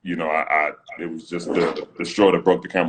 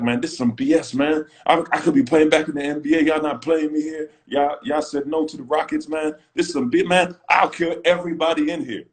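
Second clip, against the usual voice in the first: high, raised mock rant at the team; hammed-up angry outburst, pitched up; retelling his blow-up at the organization; retold rant; 8 semitones higher than usual. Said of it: "Never in my life ... said".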